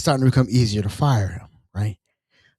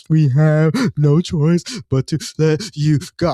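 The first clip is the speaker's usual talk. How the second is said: slow, sobbing, halting delivery; mock crying; 3 dB louder, slower than usual. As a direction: break up the words with pretend sobs. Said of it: "I'm crying with".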